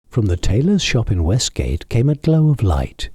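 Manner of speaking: ordinary delivery, nothing marked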